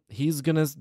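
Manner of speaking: ordinary delivery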